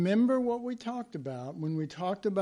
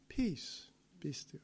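quiet command; 9 dB quieter than usual. Acted. Jesus stilling the storm.

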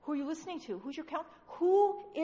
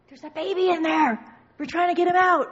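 loud, higher-pitched insistence; urgent shouting; 5 semitones higher, 9 dB louder than usual. Acted. Birth helper saying a baby is coming, urgent shout. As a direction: shout it like an emergency.

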